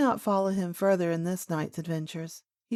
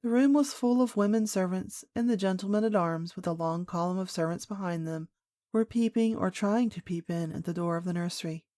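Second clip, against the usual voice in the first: usual speaking voice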